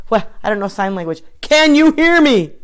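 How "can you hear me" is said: calling out. Real, not acted. Calling out to muted viewers.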